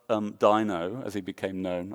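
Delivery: usual speaking voice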